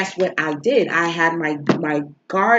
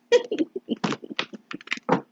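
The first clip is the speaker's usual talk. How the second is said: high breathy laugh; 4 dB quieter than usual. Real, not acted. Laughing after shirt story.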